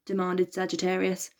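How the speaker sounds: usual speaking voice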